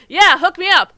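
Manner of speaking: eager shout. Retold or imitated. Ling eagerly agreeing.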